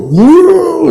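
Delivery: high, loud whooping cry of delight; a sudden falsetto "Wuh" of excitement; imitated whoop. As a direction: mimic the wild young crowd's whoop. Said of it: "seperti tadi diminta sama itu".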